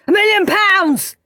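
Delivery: high cheeky shout. Acted. Ralph shouting guess at prize, cheeky. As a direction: yell it out across the room. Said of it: "shouted Rude Ralph".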